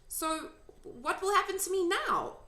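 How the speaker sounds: a hint of a young girl asking; young girl Sophie. A narrator's reading. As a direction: speak up as a curious little girl. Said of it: "asked Sophie".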